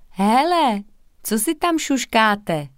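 calling across the street, curious. A narrator's reading. Girl calls across the street to whispering friends, curious. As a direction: raise the voice to reach them, nosy. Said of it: "zavolala na ně zvědavá Katka".

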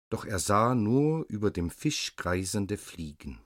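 usual speaking voice

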